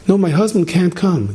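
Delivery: wife pleading with the king for her husband. Acted. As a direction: beg the king not to be angry.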